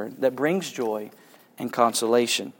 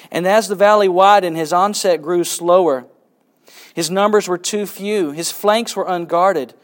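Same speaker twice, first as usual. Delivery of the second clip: rousing, raised read-aloud voice for a battle scene; story narration; 4 semitones higher, 6 dB louder than usual. A narrator's reading. Teacher reading a Hobbit battle to the class, dramatic narration. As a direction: give the battle its sweep and weight.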